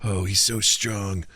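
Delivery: hushed, mock-dramatic inner thoughts; anime internal monologue. Imitating a fighter thinking mid-battle, mock-earnest internal monologue. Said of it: "there are some anime where the internal dialogue".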